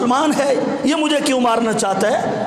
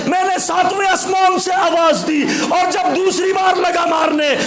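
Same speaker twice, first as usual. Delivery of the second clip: preaching at full force; 6 semitones higher than usual. Acted. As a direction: proclaim it to the crowd, voice raised high. Rider's speech preached at full force. (آسمانی سوار کی زبانی پرجوش، بلند خطابت)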